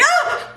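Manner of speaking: high acted yelp; mock startled yelp. Reacting as he goes in to hug, mock yelp. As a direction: yelp as if grabbed unexpectedly. Acts alarm at his hug.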